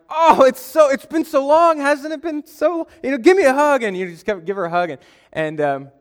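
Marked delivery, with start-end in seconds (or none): 0.1-3.8 high, loud, hammed-up gushing; fake excitement. Greeting a relative he can't place, faking excitement. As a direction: gush to cover that you're clueless. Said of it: "I acted all excited to see her".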